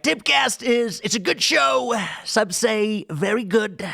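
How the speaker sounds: high-pitched, exaggerated rally impersonation; Donald Trump. Shown as a demonstration. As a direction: ham up a politician working a rally crowd, drawing out the last phrase. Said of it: "impersonation of what I would describe as rally Donald Trump".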